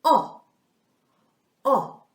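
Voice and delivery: "O, O, O" said loud, apart; phoneme O. Shown as a demonstration. Teacher showing the action sound "O" to children. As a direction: chant "O" with each switch of the light. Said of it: "and you say".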